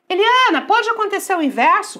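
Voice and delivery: voz mais aguda e forte de aluno curioso; aluno perguntando. Acted. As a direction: imite um aluno chamando a professora. (aluno perguntando em voz aguda)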